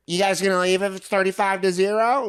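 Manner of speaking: high-pitched heckling woman; imitated tailgater. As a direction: needle rival fans in a high put-on voice. Voicing the hammered lady's heckle.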